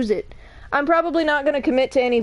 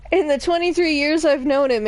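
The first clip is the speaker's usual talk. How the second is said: usual speaking voice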